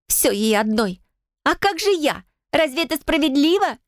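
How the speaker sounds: high, panicked jealous wailing; shrieking in fear and anger. Acted. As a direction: lash out like a trapped animal. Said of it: "завизжала то ли от страха, то ли от злости".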